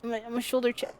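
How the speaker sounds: ordinary talk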